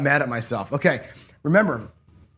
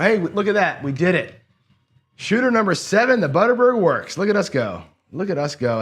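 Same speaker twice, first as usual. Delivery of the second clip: real excitement, higher and louder; excited; 6 semitones higher, 4 dB louder than usual. Real, not acted. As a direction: celebrate the win, voice jumping up. System finally wins.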